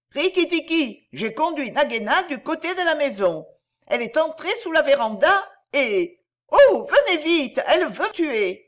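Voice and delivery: high, urgent calling out; crying at full voice. A narrator's reading. Darzee's wife warns Rikki-Tikki to come quickly, crying out. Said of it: "crier à tue-tête".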